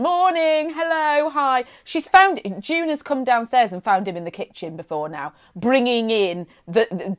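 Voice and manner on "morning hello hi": cheery bin man. Retold or imitated. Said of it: "he'll have a lean".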